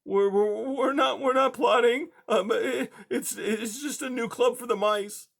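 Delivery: high, hesitant scared mouse; timid high little voice; frightened mouse. A narrator's reading. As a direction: stammer it out in fear. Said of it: "Blinker managed to say".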